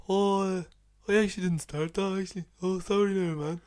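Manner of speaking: high-voiced imitation of a dopey friend; mock apology, pitched well up; sheepish excuse. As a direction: imitate a mate making excuses.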